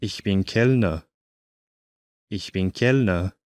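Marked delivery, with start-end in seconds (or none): none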